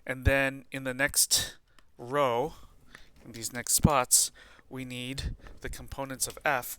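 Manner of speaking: ordinary talk, nothing marked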